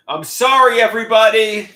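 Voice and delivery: loud, high public apology to everyone. Real, not acted. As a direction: call out sorry to the whole chat.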